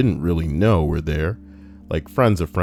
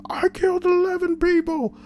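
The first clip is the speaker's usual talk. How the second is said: very high-pitched strained mock scream. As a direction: act out screaming at the top of your lungs without real volume. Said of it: "He screamed at the top of his lungs".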